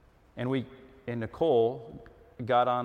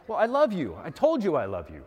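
defensive, raised-pitch protest; acted husband insisting he already said it; exasperated husband; 4 semitones higher, 4 dB louder than usual. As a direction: push back, irritated. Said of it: "without the emotion involved that, that you can hear in my voice".

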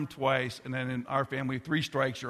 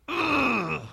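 a high, loud groan of frustration; preacher acting out everyday frustration with a loud ugh; frustrated groan; 12 semitones higher, 7 dB louder than usual. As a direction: let out an exasperated ugh. Frustration in the sermon.

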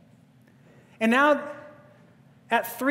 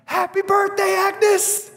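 much higher and louder group cheer; surprise shout; 12 semitones higher, 5 dB louder, slower than usual. Retold or imitated. The packed diner shouting to surprise Agnes, loud cheer. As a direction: burst out yelling the greeting.

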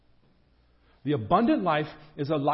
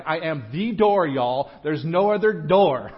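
louder, emphatic declaration; definitive; 7 dB louder than usual. Retold or imitated. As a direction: state it as final, punching the key words. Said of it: "He's saying this definitively".

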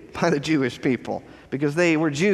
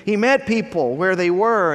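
ordinary talk

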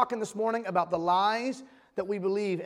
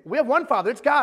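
louder, higher, indignant retort; defensive; 4 semitones higher, 8 dB louder, faster than usual. Retold or imitated. Pastor voicing the Pharisees' indignant comeback, defensive and loud. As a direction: snap it back like they're offended. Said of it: "they're getting really defensive".

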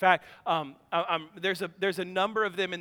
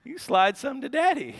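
mock greedy dad; 5 semitones higher, faster than usual. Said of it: "and I'm like".

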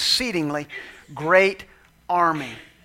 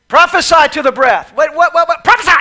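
loud, high back-and-forth; loud exchange; 9 semitones higher, 11 dB louder than usual. Acted.

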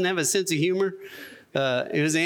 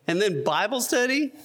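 higher, rising disbelief on STUDY; incredulous; 6 semitones higher than usual. Retold or imitated. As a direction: question Bible study as if it's the last straw. Disbelief at the invitation's last item.

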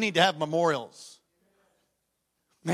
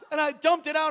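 ordinary talk, nothing marked

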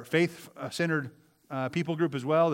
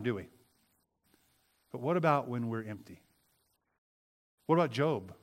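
ordinary delivery

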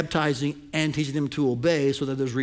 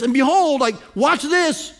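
high and loud, building excitement; preacher pointing hard at the key word; emphatic excitement; 8 semitones higher, 6 dB louder than usual. Real, not acted. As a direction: urge them not to miss it. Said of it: "I love the way Christ says".

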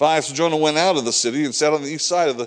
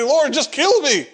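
a preacher's mild imitation of Jonah, higher and slower; Jonah's despairing plea; 9 semitones higher, 3 dB louder than usual. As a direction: pitch climbing higher, slowing into a pleading cry. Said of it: "he's gonna say".

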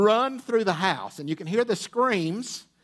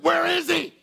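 higher, tense retold urgency; mock urgent whisper-shout of a worried husband; urgent demand; 11 semitones higher than usual. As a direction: demand it like there's an intruder. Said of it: "adrenaline is up right here".

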